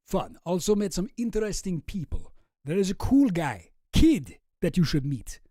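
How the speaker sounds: amateur mock-Russian accent, Dracula-like; Boris with Russian accent. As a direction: read the email in a put-on Russian accent. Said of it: "a Russian accent".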